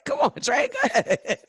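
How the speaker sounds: high playful hype call; hyping up. Real, not acted. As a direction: egg her on playfully. Hyping friend to answer.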